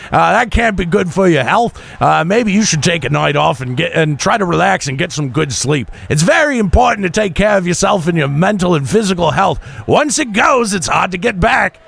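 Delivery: put-on Australian accent. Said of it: "Pete Dunne is suddenly Australian".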